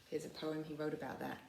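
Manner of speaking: plain reading voice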